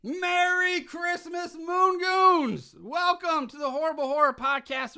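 big booming showman intro, high and loud; hype host voice. Acted. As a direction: shout it like a wild holiday emcee. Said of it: "I haven't done that in a while".

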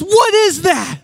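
high, loud mock shriek of accusation; hammed-up furious mom; outraged mom. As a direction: pounce like a mother catching her kid. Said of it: "She goes".

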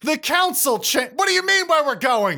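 high, rushed irritation; impatient scolding. Acted. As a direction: hurry him up, fed up. Longgrass scolding Dax, hurried.

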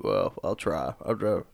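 low, soft put-on voice; half-hearted impression, dropped low; Will. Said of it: "a better impression of me than that".

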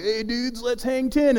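high, laid-back surfer dude. Retold or imitated. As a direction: play a goofy beach surfer. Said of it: "I end up talking like a surfer".

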